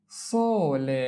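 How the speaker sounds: slow, lengthened "sole" for learners; drawn-out model. Shown as a demonstration. Teacher models sole for learners, drawn out. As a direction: stretch "sole" out, holding the o.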